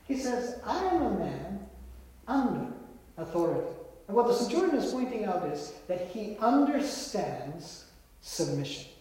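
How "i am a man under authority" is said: "under" set apart by pauses before and after; stress on under. Shown as a demonstration. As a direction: isolate "under", then let "authority" drop. Corrects misquote, setting UNDER apart.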